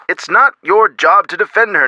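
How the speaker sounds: ordinary delivery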